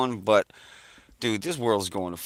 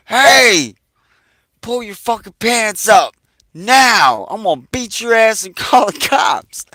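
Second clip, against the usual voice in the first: much higher and louder yelled order, a laugh slipping in; retold shout; 10 semitones higher, 10 dB louder than usual. Buddy Lou yelling at the naked crackhead, loud with a laugh. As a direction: shout it down the lot, cracking up near the end. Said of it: "He's like".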